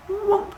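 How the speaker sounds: high-pitched "whoomp" sound effect; imitated whoosh. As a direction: voice the light switching off with a high whoomp. Imitates the light going out.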